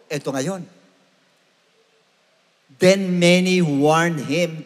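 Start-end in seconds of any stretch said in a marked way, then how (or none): none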